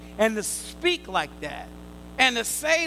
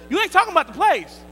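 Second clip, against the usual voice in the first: ordinary talk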